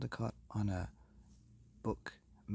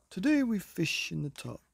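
louder, higher king's proclamation; booming king; 9 semitones higher, 5 dB louder than usual. Acted. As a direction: announce it grandly as the king. King answers in his voice.

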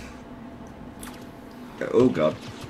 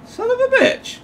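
exasperated curse. Real, not acted. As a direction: curse pitched far up, stretched. Nobody in cave, annoyed.

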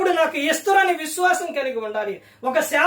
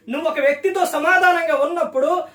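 normal talking voice

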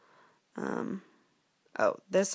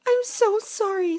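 high, loud, drawn-out apology; apologetic princess; 13 semitones higher, 5 dB louder, slower than usual. Acted. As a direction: plead as a startled princess. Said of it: "said the princess".

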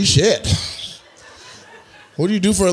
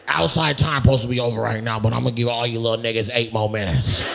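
lower-pitched mocking mimicry; imitating audience member; 6 semitones lower, 4 dB quieter than usual. Comic playing the man talking to YMCA kids, mocking imitation. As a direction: play him as a kids' supervisor, pitched low. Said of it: "'Cause he be like".

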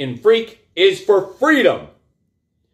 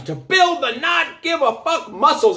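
fired-up shout; 9 semitones higher than usual. Real, not acted. Fired-up motivational rant.

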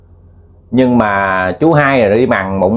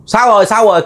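calling out; 10 semitones higher, faster than usual. Retold or imitated. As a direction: call out for Sáu into the dark. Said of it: "mới kêu".

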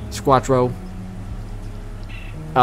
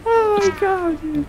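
laughing; slower than usual. Real, not acted. Laughing at chaos in game.

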